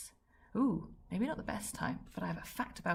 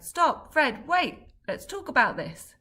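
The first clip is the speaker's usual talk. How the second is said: high, louder calls to stop; shouting after him; 8 semitones higher, 9 dB louder, slower than usual. Acted. Dad shouting after Fred to stop and wait. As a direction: shout him back as he storms off. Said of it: "He started shouting things like".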